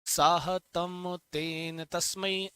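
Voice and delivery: slow, deliberate chant of साहतम तेन तस्मै; slow recitation. Shown as a demonstration. Teacher prompting students to repeat, chanting slowly. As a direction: lead the class through it slowly. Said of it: "बोलो, बोलो".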